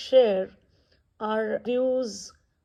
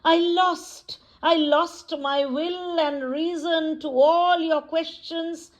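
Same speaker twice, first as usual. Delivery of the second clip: higher-pitched, lilting poetry reading with measured pauses; poem recitation; 5 semitones higher than usual. Acted. Poet reciting a woman's raw poem to listeners, dramatic recitation. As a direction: recite it in sustained, raised tones, phrases dropping away at stanza ends.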